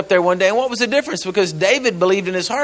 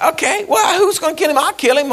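David, casual; 11 semitones higher than usual. Acted. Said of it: "He's like".

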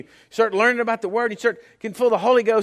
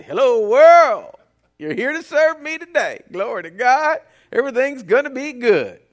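triumphant greeting; 5 semitones higher, 8 dB louder than usual. Pretend. Believer walking out the door, loudly triumphant. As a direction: greet the day like you own it. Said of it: "Walk out the door and say".

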